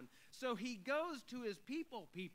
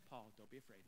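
much softer and lower, tender; gentle God voice; 11 semitones lower, 12 dB quieter, faster than usual. Acted. God softly reassuring the heartbroken Paul. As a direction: speak it like a hand on his shoulder.

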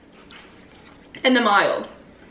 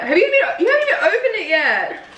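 laughing; 8 semitones higher than usual. Real, not acted. Laughing, teasing about unopened sauce.

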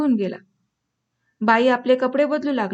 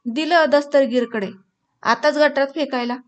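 ordinary delivery, nothing marked

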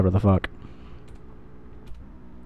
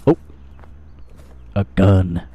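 ordinary talk

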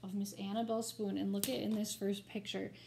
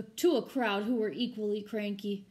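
usual speaking voice

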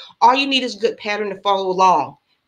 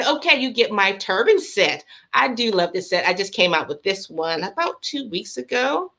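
normal talking voice

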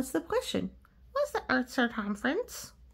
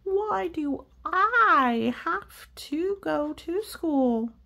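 higher, slow whiny pigeon; 8 semitones higher, 5 dB louder, much slower than usual. Acted. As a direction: whine it like a reluctant bird. Pigeon voice whining.

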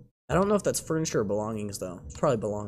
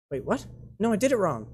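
ordinary delivery

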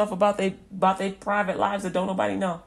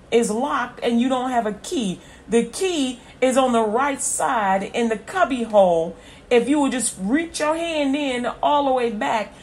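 loud, raised, driving proclamation; prophetic preaching; 5 semitones higher, 7 dB louder than usual. Acted. Prophet directing someone to the hidden key, forceful preaching. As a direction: drive each instruction home at full voice.

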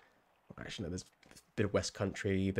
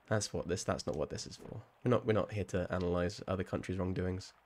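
ordinary delivery

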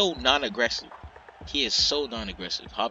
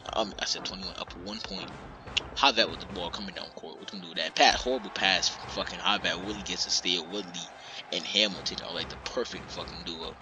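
ordinary talk, nothing marked

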